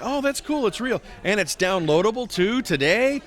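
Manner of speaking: growing excitement. Retold or imitated. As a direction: start cool, then let the thrill break out. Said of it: "It's like".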